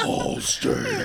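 mocking voiceless demon announcer; hammed-up demon reading an ad tagline; demonic insurance salesman. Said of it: "like a character from Little Nicky".

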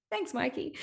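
high, soft, laughing thank-you; laughing ironic thanks. Real, not acted. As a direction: toss off a wry, laughing thanks to the ex.

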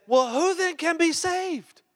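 high, slow, incredulous cry; astonished disciples. Retold or imitated. Disciples asking Jesus in disbelief, high astonished voice. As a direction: gasp it out like a stunned disciple. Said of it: "they said".

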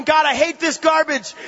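high, slow cry of anger to God; angry prayer. Retold or imitated. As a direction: vent it loudly in retold frustration. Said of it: "I was getting angry".

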